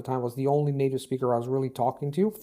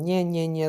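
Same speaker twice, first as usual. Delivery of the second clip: a whiny mocking "Nyeh" a little higher; 3 semitones higher than usual. Classmates mocking his new American accent, taunting nyeh. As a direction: imitate classmates' taunting nasal sneer. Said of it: "they were, like, doing this sound".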